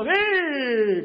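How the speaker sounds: put-on high, loud childish yes; spoiled teen. Teen son accepting mom's sandwich, high and childish. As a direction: answer like a pampered kid. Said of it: "un sanguchito".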